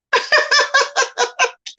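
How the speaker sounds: high burst of laughter; real laughter. Real, not acted. Streamer cracks up over who he's shouting to, real laughter.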